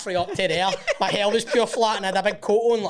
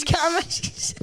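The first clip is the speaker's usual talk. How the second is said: high teasing singsong; mocking the friend's new girlfriend; mock high echo; 10 semitones higher than usual. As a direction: repeat it in a silly high voice.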